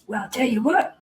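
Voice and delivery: high, menacing cartoon officer; Foghorn Leghorn. Petty officer about to berate a recruit, high cartoon voice. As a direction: mimic a blustering officer winding up a threat. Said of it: "they do sound like Foghorn Leghorn".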